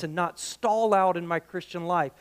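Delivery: usual speaking voice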